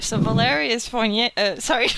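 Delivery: ordinary delivery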